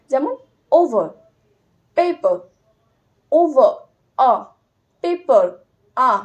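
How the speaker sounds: teacher pointing out the schwa; schwa ending demo. Shown as a demonstration. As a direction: isolate the final আ. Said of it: "এই জায়গাটার জন্য".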